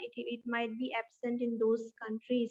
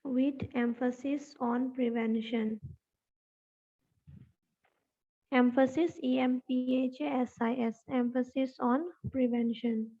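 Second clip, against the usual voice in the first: dictation. Real, not acted. Dictates notes, spelling words out.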